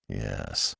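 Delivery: very low and soft; grudging and sour; a bitter, low yes. A narrator's reading. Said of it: "with bitterness".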